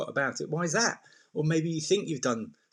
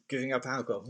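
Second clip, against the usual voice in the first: usual speaking voice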